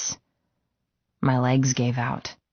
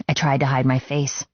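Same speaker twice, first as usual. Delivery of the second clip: plain reading voice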